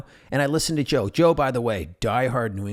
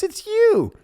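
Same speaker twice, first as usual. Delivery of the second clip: high, loud delighted greeting; light up like you haven't seen him in years; excited dad; 9 dB louder than usual. Retold or imitated.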